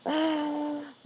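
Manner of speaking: high, breathy gasp of delight. Real, not acted. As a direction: gasp like you just saw something wonderful. Delight at Jane Austen's house.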